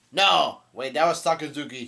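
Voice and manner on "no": loud, sharp retold refusal; a quick burst of mock anger; angry no. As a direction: fire off an angry no. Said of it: "gets angry and says".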